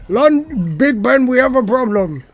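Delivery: high mock-British distress call; radio in trouble as a hammed-up British pilot; British accent. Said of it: "Why would a British person call Houston?".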